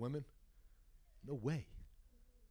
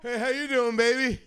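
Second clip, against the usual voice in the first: loud come-on; 5 semitones higher, 6 dB louder than usual. Retold or imitated. Hitting on woman.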